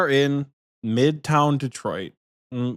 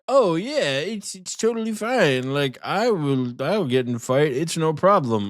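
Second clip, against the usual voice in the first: carefree bro; 4 semitones higher than usual. Pretend. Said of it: "You would be like".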